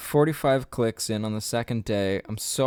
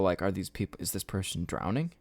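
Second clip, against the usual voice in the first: usual speaking voice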